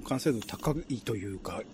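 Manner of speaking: ordinary delivery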